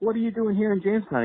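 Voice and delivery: high, eager starstruck question; starstruck excitement. Retold or imitated. Starstruck fan asking his wrestling hero, high and excited. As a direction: gush at him like a kid meeting his hero.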